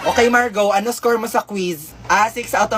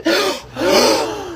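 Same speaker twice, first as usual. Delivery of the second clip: shocked gasp; 8 semitones higher than usual. Acted. Flames result shock.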